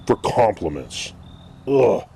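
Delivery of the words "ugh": normal talking voice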